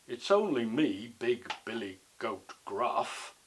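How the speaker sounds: slow, weighty goat voice; drawn-out, self-important storybook goat; big goat character. Acted. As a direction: announce yourself slowly as the biggest goat.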